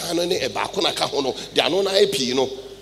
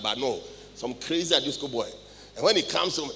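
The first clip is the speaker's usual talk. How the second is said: ordinary talk, nothing marked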